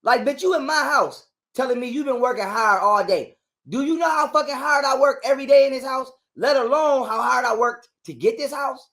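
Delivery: incensed father ranting about his house; outraged. Real, not acted. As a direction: vent the outrage hard. Outraged at her disrespect.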